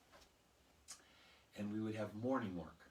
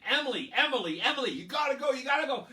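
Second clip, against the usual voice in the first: put-on much higher, louder kids begging; urgent pleading; 14 semitones higher, 14 dB louder, faster than usual. Classmates urging Emily to fetch the teacher, high and loud. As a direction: plead with her like a panicked kid.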